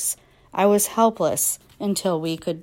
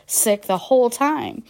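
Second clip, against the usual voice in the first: ordinary delivery, nothing marked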